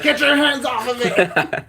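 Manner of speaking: high, loud drawn-out shout; shouted in hammed-up alarm; mock outraged yell. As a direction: yell it like someone guarding their card. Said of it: "someone be like".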